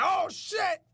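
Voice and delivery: high mock shock; startled yelp.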